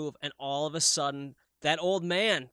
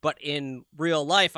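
ordinary delivery

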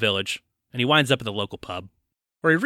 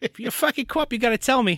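high, put-on English accent; a squeaky cartoonish English copper; mock English accent; 10 semitones higher than usual. Co-host riffing on the film cop in a high mock English accent. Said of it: "This is my English accent now".

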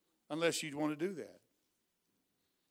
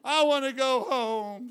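put-on much higher and louder, slow, a stretched child-like whine; Mitzi's whining plea; 7 semitones higher, 10 dB louder, slower than usual. Preacher voicing Mitzi begging her aunt, high loud whine. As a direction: imitate her begging to go home. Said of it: "she said".

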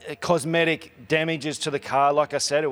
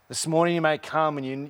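ordinary talk, nothing marked

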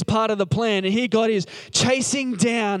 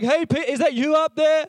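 high-pitched call up from downstairs; mock yelling out; 7 semitones higher than usual. Men sent for Peter call from downstairs, mock yelling out. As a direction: call up to someone upstairs, not full volume. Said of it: "yelling out from downstairs".

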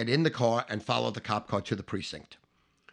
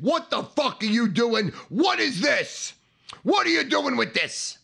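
high, outraged guard's yell; angry shouting; 10 semitones higher, 3 dB louder than usual. Retold or imitated. Guards grab the young men and demand answers, angry shout. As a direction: shout accusing questions at a culprit. Guards angrily confront the culprits.

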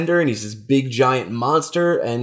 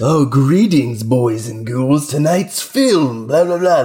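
slow, over-the-top horror host; hammy creepy TV host greeting; ghoulish horror host; 2 semitones higher, slower than usual. Retold or imitated. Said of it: "a little more over the top".

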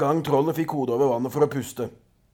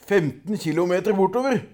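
plain reading voice

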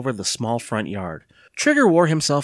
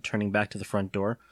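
plain reading voice

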